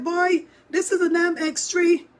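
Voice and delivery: high, loud retold scolding; exasperated mother. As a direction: imitate a mom telling off her boy.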